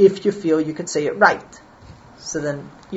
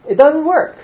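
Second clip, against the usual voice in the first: laughing; 10 semitones higher, 6 dB louder than usual. Real, not acted. Joke about CPR.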